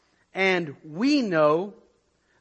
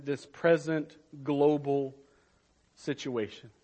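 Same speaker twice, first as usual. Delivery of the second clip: gentle assurance; 4 semitones lower, 6 dB quieter than usual. Acted. Pastor comforts the church amid the pandemic, soft and sure. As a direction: reassure the congregation quietly. Quiet assurance after reading.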